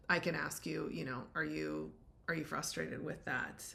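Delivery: ordinary talk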